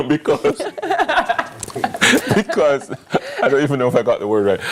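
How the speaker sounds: fast, laughing through the words; giggling at her own slip. Real, not acted. Host laughing after stumbling on the day's name. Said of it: "laughing at own stumble".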